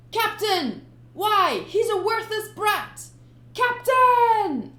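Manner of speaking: high, loud pleading shout; desperate crewman. Acted. Crewman begging the captain, high and loud. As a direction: cry out in disbelief.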